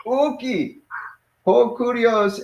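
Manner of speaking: "Uki" pitched high, then a high "ho kurios"; emphatic no. Shown as a demonstration. Teacher models denying it really emphatically, emphatic. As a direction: open with an insistent "Uki". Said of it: "you wanna say it really emphatically".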